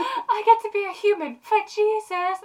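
high, slow, drawn-out put-on voice; mock rapture. Imagined Edward thrilled to play a human, mock-rapturous. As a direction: gush it in exaggerated longing.

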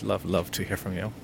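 usual speaking voice

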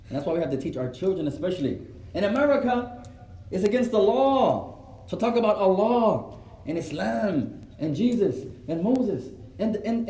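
ordinary delivery

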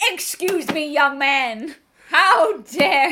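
huffy granny voice, rising to loud indignation; prim grandma. Retold or imitated. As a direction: play an affronted sixty-five-year-old grandma. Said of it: "fully turns into a sixty-five-year-old, like, grandma".

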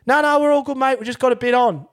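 loud, high imitation of a busy nurse. Busy nurse reassuring him, acted.

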